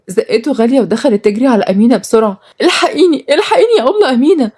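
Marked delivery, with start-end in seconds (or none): 2.6-4.5 high, urgent cry for help; panicked plea. Acted. Runaway woman to her protector, panicked. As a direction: beg for rescue in a raised pitch.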